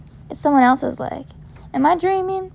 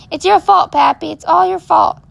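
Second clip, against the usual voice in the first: loud, higher accusing cry; yell of blame; 5 semitones higher, 5 dB louder than usual. Acted. Girl in the ambulance blames her grandfather, loud accusing yell. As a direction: throw the blame at him. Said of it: "I wanna yell".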